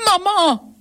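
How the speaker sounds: high startled cry of Mom; cri de réveil. A narrator's reading. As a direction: cry out for her mother, suddenly woken. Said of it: "elle se met à crier". (cri soudain, voix aiguë)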